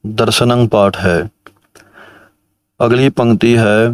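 ordinary delivery

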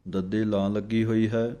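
ordinary talk

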